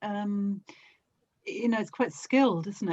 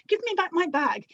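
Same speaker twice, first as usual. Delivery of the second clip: put-on old lady demanding; 8 semitones higher than usual. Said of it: "and they".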